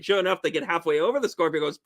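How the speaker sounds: ordinary delivery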